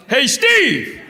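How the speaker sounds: high holler across a movie theater; loud, high-pitched call; yelling out. Retold or imitated. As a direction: yell it to get his attention. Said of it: "I just yell out".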